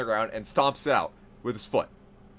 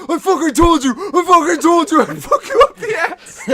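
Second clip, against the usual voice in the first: manic yell; 11 dB louder than usual. Retold or imitated. Jared yelling on top of Paul.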